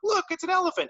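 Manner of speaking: amazed crowd. Retold or imitated. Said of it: "everyone's like".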